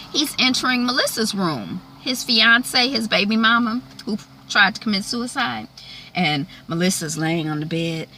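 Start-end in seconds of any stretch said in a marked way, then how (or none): none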